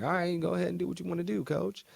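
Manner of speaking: high, fast teasing voice; mocking Sherrod. Sherrod shrugging off Aaron's revenge plan, mock high and fast. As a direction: toss it off quickly and high, like egging him on. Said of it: "And Sherrod's like".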